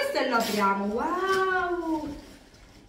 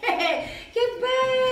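laughing; 9 semitones higher, 5 dB louder, much slower than usual. Real, not acted. Laughing delight.

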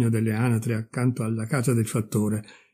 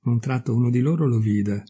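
ordinary delivery, nothing marked

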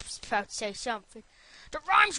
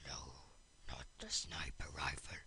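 lower, soft frightened whisper; 13 dB quieter than usual. Pretend. Begging the enemy not to use the rifle, whispered. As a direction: breathe it out in dread.

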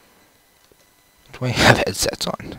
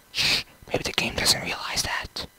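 much softer whispered hush; shushing whisper; 6 semitones lower, 8 dB quieter than usual. Real, not acted. Sleeping, not waking game.

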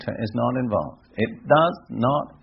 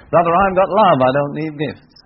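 louder, higher mock-insistent protest; imitated insistent objector; 4 semitones higher, 7 dB louder than usual. Mimics believer rejecting gifts.

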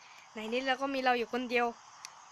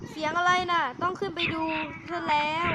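startled; 5 semitones higher than usual. Acted. Clerk hears noise upstairs.

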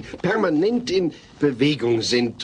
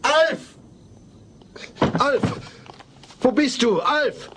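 calling out; 10 semitones higher, slower than usual. Acted. Dad searching after earthquake.

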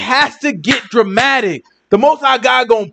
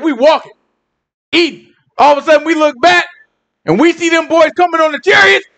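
ordinary talk

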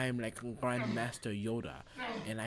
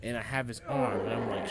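normal talking voice

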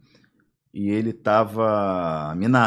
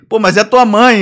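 high, loud objection; hammed-up retold shock; mock appalled protest; 9 semitones higher, 11 dB louder, slower than usual. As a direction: cry out as a scandalised crook. Appalled kidnapper quoted once more.

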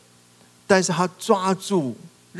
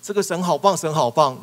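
bright, louder burst of wow; gushing praise; 5 semitones higher, 6 dB louder than usual. Pretend. Worshipper gushing praise outside God's door. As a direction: gush like an eager worshipper. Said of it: "哦，好像".

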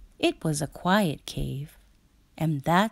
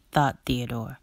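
ordinary delivery

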